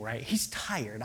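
normal talking voice

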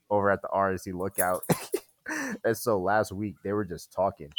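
normal talking voice

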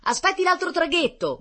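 high, loud call across water; sailor calling out. A narrator's reading. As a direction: shout it over to the man. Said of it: "gridò un marinaio a quel signore".

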